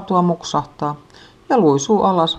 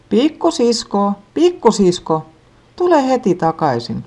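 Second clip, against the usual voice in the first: calling from afar; 5 semitones higher than usual. A narrator's reading. Mother calling the lost little sister from far away. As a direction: call her name far off. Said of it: "kuulee kaukaa äidin huutoa".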